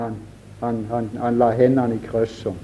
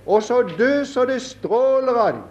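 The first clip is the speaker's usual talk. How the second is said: fervent preaching; 8 semitones higher than usual. Acted. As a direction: lift it up and hold the fervour. Preaching at full force.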